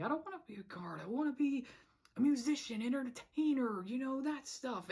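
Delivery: high, whiny would-be musician; mock-whiny dreamer; whiny Percy. Acting out Percy's dreamy complaint.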